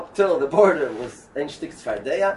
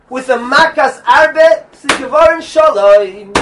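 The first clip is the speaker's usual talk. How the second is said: higher, louder, emphatic sermon; preaching at full force, higher and louder; fiery preaching; 11 semitones higher, 11 dB louder than usual. Acted. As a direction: drive the lesson home to the crowd.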